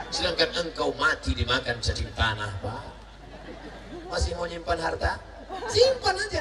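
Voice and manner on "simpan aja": mocking falsetto. Mocking permission.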